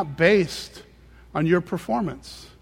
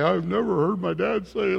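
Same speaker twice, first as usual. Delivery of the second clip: bitter complaining. Shown as a demonstration. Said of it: "I'm not saying it like that".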